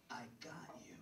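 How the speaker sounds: soft, breath-only reassurance; smooth whisper. Retold or imitated. Teenager reassuring a girl afraid of flying, smooth whisper. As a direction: whisper it suavely, as if protecting her.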